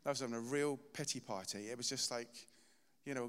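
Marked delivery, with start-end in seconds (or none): none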